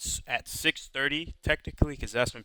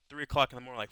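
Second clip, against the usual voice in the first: ordinary talk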